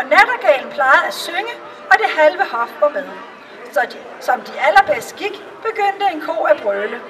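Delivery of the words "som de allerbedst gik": plain reading voice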